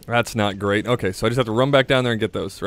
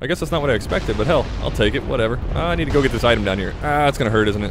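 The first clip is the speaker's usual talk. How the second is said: usual speaking voice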